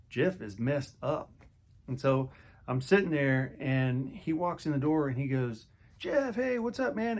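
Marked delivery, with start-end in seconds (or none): 6.0-7.2 cheery greeting. Retold or imitated. Doctor's cheerful greeting voiced.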